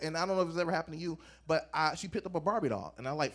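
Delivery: normal talking voice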